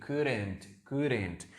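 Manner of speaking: Couldn't said "kudent", the l silent; pronunciation drill. Shown as a demonstration. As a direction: repeat Couldn't slowly as "kud-ent". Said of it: "Remember the pronunciation".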